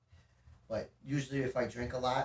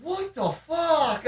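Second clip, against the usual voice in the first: high, drawn-out, loud retold cry; exasperated outburst; 7 dB louder, much slower than usual. Interrupted on the couch by a friend, exasperated. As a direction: stretch it out, startled and annoyed. Retells startled annoyed reaction.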